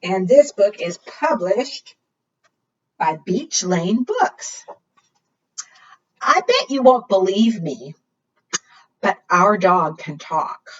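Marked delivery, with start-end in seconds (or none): none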